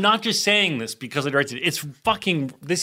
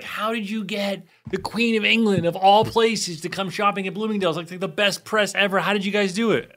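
high, excited reporters asking; excited media; 8 semitones higher than usual. Retold or imitated. Reporters marvel at the Queen's visit, excited and high. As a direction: pitch it up like amazed reporters. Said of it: "the media was going crazy".

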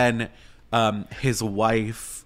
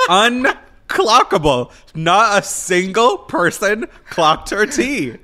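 excited; 9 semitones higher, 5 dB louder, slower than usual. Real, not acted. Gushing over Mrs Doubtfire.

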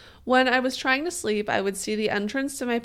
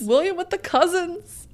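playful dramatic; 9 semitones higher, 4 dB louder than usual. Pretend. Mock dramatic name call.

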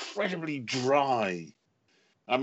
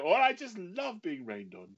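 sarcastic; 7 semitones higher, 3 dB quieter than usual. Retold or imitated. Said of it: "she used to say things like".

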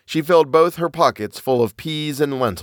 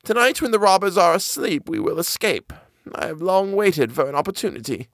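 put-on high old woman's urgent hush; 8 semitones higher than usual. A narrator's reading. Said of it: "she said".

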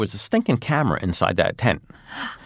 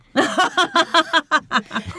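long, high-pitched laugh; burst of laughter. Real, not acted. Listener bursts out laughing at friend's remark.

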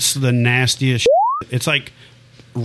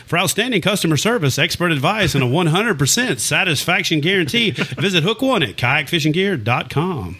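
high-pitched, punchy ad read; TV announcer voice; 6 semitones higher than usual. Shown as a demonstration. Host proves to shop customers it is him, TV voice. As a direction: switch on the polished sponsor-spot delivery. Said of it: "you don't sound nothing like you sound on T V".

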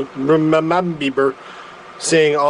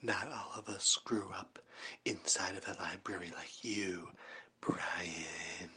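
breathy stage whisper, very soft and low; 11 semitones lower, 23 dB quieter than usual. Acted. Teasing Brian about growing up in a library, whispered. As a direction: whisper it like a secret taunt.

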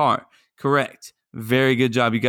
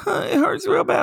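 high-pitched imitated child; hurt little girl. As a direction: put on a hurt kid's voice. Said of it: "my daughter fell and bumped her knee".

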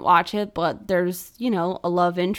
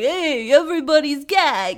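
loud, higher bro voice; hammed-up macho dude greeting; macho guy; 8 semitones higher, 6 dB louder than usual. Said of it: "like a macho".

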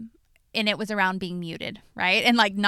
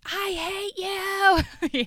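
mock raging cry; 11 semitones higher, 3 dB louder, much slower than usual. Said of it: "the moment where I'm raging".

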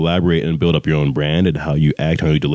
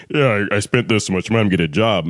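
entitled student; 3 semitones higher than usual. Retold or imitated. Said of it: "don't walk in like".

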